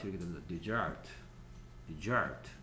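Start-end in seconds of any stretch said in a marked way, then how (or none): 0.5-1.1 "desert" with the stress moved off the first syllable, first vowel weak; other stress on desert. Shown as a demonstration. Teacher showing students the second stress of desert. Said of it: "강세를 주면 얘가 소-, 힘이 없어지기". 1.9-2.5 "desert" with the stress moved off the first syllable, first vowel weak; other stress on desert. Shown as a demonstration. Teacher showing students the second stress of desert. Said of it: "강세를 주면 얘가 소-, 힘이 없어지기".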